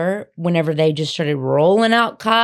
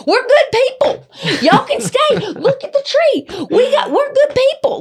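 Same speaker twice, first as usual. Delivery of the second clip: very high, loud sweet grandma voice; Nana; 3 dB louder than usual. Retold or imitated. Nana selling strangers on staying, very high and loud. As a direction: chirp it like an eager old hostess. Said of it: "she was like".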